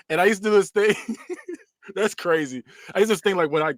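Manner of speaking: normal talking voice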